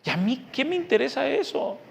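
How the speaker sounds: jumps way up in pitch, scoffing; dismissive retort. Real, not acted. Preacher brushing off talk of big screens, scoffing high. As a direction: toss the question back in disbelief.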